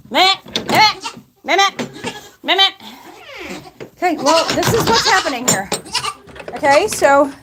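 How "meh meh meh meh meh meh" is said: a playful goat impression, meh meh; imitating goat bleats. Farmer bleating back at her noisy goats, teasing goat impression.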